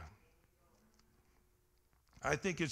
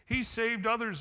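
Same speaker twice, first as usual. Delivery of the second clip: scoffing; 5 semitones higher, 5 dB louder than usual. Retold or imitated. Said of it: "they scoffed".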